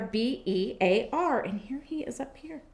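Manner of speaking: ordinary talk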